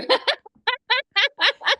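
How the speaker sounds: loud high burst of laughter. Real, not acted. Laughing at her friend.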